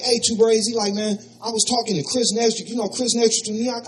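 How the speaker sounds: Ron's voice. Retold or imitated. Ron calls on phone.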